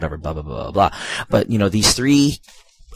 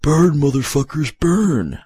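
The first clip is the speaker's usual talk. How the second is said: high, pitched-up special-move cry; heavy knight's battle cry; 8 semitones higher, slower than usual. Acted. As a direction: belt it out as a mock battle cry. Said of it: "when you do his special move, he goes".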